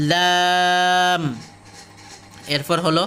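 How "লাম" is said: লাম held long on a level tone; two-alif stretch. Shown as a demonstration. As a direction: draw out the "aa" of লাম for two counts. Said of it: "এগুলো দুই আলিফ করে".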